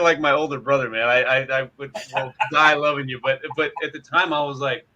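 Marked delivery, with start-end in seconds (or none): none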